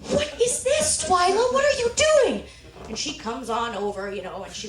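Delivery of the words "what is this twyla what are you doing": very high, loud disbelief; high-pitched, appalled yelp; aghast. Retold or imitated. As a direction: cry out in mock horror. Aghast at grandma stabbing possum.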